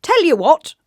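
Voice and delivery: high, loud jolly joker voice; Mister Funny's voice. A narrator's reading. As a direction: announce it like an eager clown. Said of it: "said Mister Funny".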